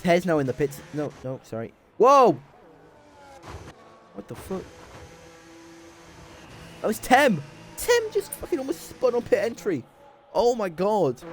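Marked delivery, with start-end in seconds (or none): 2.0-4.6 loud, high-pitched whoa; sharp, astonished exclamation; startled shout. Real, not acted. Startled by on-track near miss. 6.8-11.2 high-pitched, incredulous live reaction; amazed, squeaky-high commentary; astonished exclamation. Real, not acted. Astonished at near spin.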